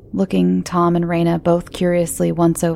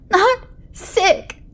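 put-on yelling child. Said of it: "the boy yelled".

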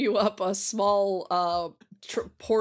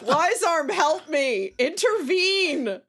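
pleading; 7 semitones higher, 4 dB louder, slower than usual. Acted. Pleading to character, raised.

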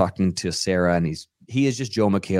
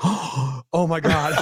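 gasp, then a slow, higher-pitched exclamation; retold shocked gasp; 7 semitones higher, much slower than usual. Guest learns the house really burned down, shocked. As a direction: draw it out as horrified realization.

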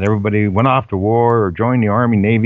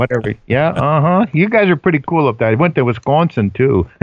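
higher, lilting mock Minnesota accent; put-on Minnesota accent; 4 semitones higher than usual. Guest ribbing the folks up there, mock Minnesota accent. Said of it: "How's my accent coming through".

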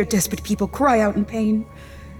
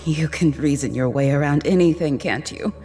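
fond teasing; 3 semitones lower than usual. Acted. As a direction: tease him lovingly. Affectionate teasing of husband.

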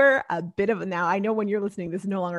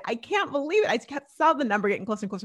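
normal talking voice

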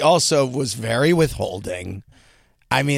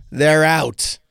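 ordinary talk

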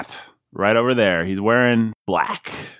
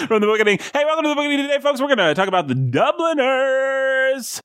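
very high, over-the-top announcer; hyped show intro. Pretend. Host welcomes listeners to the book show, hyped announcer. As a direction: stretch the final title out in a high pitch.

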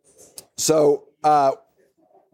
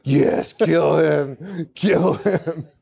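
sinister cult master; much slower than usual. Retold or imitated. Master voiced urging the kill.

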